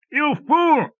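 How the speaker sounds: very high, furious scream; furious roar. A narrator's reading. Villain roaring at the lieutenant, furious high scream. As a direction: explode at him in rage. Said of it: "roared Cromley".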